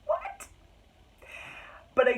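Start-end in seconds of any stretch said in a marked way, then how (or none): 0.0-0.5 shocked squeak. Real, not acted. Shocked at plot twist.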